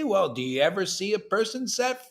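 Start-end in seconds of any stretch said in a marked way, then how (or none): none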